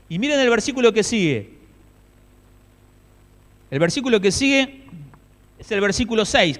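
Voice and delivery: loud, high preaching cry, slowing in places; impassioned preaching. Acted. Preacher to the congregation about the grieving soldiers, loud impassioned preaching.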